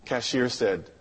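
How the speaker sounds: ordinary talk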